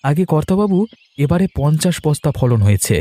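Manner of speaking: high, bright farmer presenting his crop to the headman; proudly showing off the harvest; eager farmer. Acted. (মোড়লকে ফসল দেখানো উঁচু গলার খুশি চাষি)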